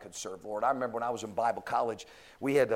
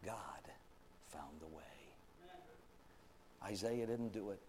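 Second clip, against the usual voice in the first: hushed preaching; 2 semitones lower, 4 dB quieter, slower than usual. Acted. Preacher quietly tells how God found the way, hushed. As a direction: drop it to a hush.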